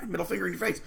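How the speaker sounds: soft mocking laugh into the words; a quiet, amused chuckle, then the words through it. Real, not acted. Mocking the narcissist's gloating.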